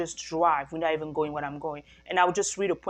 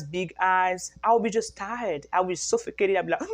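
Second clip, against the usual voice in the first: normal talking voice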